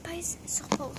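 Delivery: hushed, voiceless whisper to her cousin; whispered aside. Real, not acted. Whispering to her cousin to pause the video. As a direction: whisper the pause instruction off-mic. Telling partner to pause filming.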